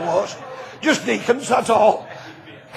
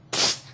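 soft psst; 11 dB quieter than usual. Retold or imitated. Someone calling softly in dark.

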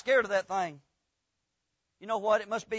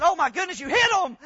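shocked; 6 semitones higher, 6 dB louder than usual. Retold or imitated. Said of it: "old boy said".

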